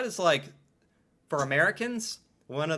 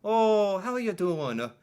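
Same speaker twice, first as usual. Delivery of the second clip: put-on chirpy higher Minnesota accent; mock Minnesota accent; 6 semitones higher than usual. Mocking American podcasters' Minnesota-style accent. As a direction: do a folksy Minnesota accent impression. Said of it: "That's kind of the".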